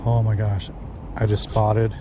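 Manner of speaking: normal talking voice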